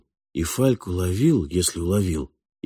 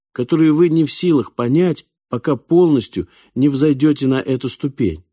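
higher, youthful, urgent voice; earnest pleading boy; 7 semitones higher, 4 dB louder than usual. Acted. Ori begs Falk to let his memory be restored, earnest and urgent. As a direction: explain insistently, pitched up, appealing to him.

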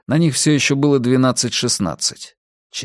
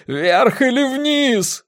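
high, long-drawn cry; frantic stretched-out yell; screaming; much slower than usual. A narrator's reading. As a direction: wail for a decision. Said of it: "завопил Грунг".

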